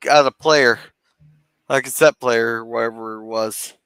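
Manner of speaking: ordinary talk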